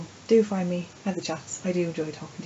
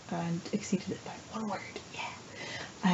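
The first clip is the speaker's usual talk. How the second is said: whispering; 7 dB quieter, slower than usual. Real, not acted. Late night quiet update.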